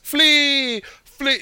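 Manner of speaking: high, drawn-out catchphrase cry; mock catchphrase. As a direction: call it out high like a silly catchphrase. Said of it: "if [name]'s name was a catchphrase".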